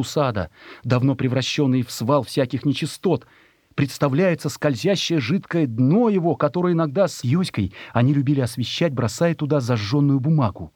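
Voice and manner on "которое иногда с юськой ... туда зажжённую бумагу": ordinary delivery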